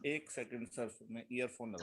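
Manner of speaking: faint voice far from the mic; distant speaker on the call; barely audible. Real, not acted. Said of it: "थोड़ा और पास आइए".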